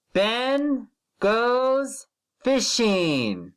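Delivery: slow, drawn-out word-by-word reading; slow model reading. Shown as a demonstration. Teacher slowly modelling a sentence for first graders. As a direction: read it slowly so the children can follow. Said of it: "Read with me".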